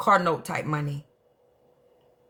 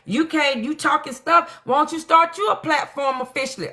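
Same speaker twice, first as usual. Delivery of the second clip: much higher, teasing dare; imitating Trina; 11 semitones higher than usual. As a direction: mimic a friend egging her on. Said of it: "Trina did her like".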